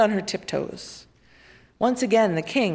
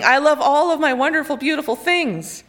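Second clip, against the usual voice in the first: higher, louder, fast gushing over treasures; the king gushes about his treasures to the woman; pleading gush; 6 semitones higher, 5 dB louder than usual. A narrator's reading. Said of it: "cried the king".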